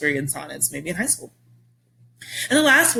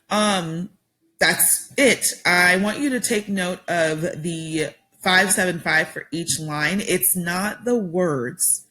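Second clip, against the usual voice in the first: ordinary talk, nothing marked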